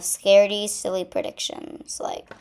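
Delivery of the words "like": plain reading voice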